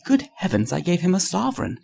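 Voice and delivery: high-pitched exclamation climbing at the end; dismayed cry. A narrator's reading. Hughie realising he tipped a millionaire, crying out in dismay. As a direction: cry it out, aghast at the blunder. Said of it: "cried Hughie".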